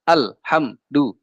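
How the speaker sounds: "Alhamdu" in separate stopped syllables, al, ham, du; wrongly chopped. Shown as a demonstration. Teacher shows forbidden reading, "Alhamdu" chopped into pieces. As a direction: break "Alhamdu" with a dead stop after l and m. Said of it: "Nggak boleh".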